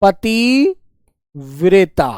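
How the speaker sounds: पतिव्रता drawn out very slowly, every syllable held; slow dictation. Shown as a demonstration. Teacher dictates पतिव्रता to pupils, very slowly drawn out. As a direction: spell it out with the voice.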